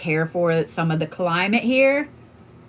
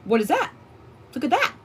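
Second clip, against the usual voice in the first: rising higher, intrigued what is that; surprised curiosity; 6 semitones higher, 5 dB quieter, faster than usual. Retold or imitated. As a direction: spot something and lean in, surprised. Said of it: "I'm like".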